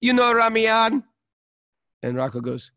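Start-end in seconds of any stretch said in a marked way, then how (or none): 0.0-1.0 quizzing guard. Retold or imitated. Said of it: "The guy at security said".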